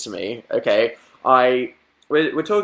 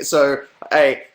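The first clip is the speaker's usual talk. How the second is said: usual speaking voice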